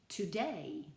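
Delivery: "today" with the weight on DAY; stress on second syllable. Shown as a demonstration. Teacher shows an iambic foot, "today" stressed on DAY. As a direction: lean on the end of "today", to-DAY. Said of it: "the last syllable is the accented one".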